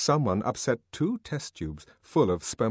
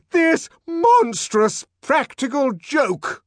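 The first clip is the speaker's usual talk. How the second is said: high, loud, slow outraged shout; 9 dB louder, slower than usual. Acted. Director shouting at the laughing room. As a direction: explode in outrage. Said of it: "the director shouted".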